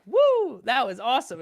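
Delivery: a big high whoop, then a thrilled, high "that was awesome"; amped up whoop. Retold or imitated. Travis to his co-driver after rolling the car, amped up. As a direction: whoop it out like a pumped rally driver after a crash. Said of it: "Travis is amped up".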